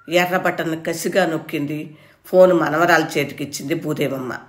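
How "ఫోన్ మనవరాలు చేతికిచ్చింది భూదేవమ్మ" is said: ordinary delivery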